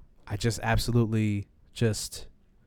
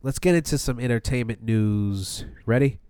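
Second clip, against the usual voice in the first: ordinary talk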